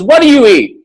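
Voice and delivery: high, loud demanding question; stern nurse grilling. Retold or imitated. Nurse grilling patient about his food, high and loud. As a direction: drill him like a strict nurse. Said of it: "she wouldn't let up".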